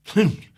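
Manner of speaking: loud grumpy humph; grunt. Acted. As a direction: grunt in annoyance. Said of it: "grunted Mister Grumpy".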